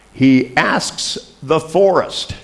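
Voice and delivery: high, very drawn-out imitated caller; relayed phone answer. Caller relays the medicine man's answer.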